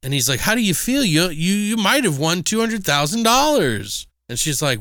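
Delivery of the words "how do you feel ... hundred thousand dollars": high, showy host voice; excited game show host. Retold or imitated. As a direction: pump up the contestant like a TV host.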